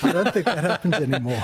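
quick, amused reply with laughter in it; laughing. Real, not acted. Doctor answering the host's school nurse joke, laughing. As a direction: rush it out through a laugh.

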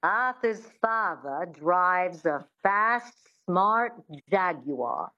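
slow, measured transatlantic accent, Rs dropped. Shown as a demonstration. Coach modelling practice sentence, slow transatlantic accent. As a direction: draw the words out in a transatlantic accent. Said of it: "use a sentence to get into accents".

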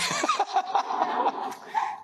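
amused burst of laughter; helpless little laughing fit. Real, not acted. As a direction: laugh at your own story. Amused by own dream story.

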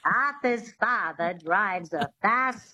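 slow, twangy Mid-Atlantic accent demo; old Hollywood Mid-Atlantic accent, said slowly. Shown as a demonstration. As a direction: dialect coach demonstrating the Mid-Atlantic accent. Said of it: "have a go at the Mid-Atlantic accent".

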